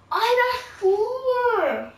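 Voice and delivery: high, loud, slow cheer; excited shout. Real, not acted. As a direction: crow the score out. Triumphant shout in game.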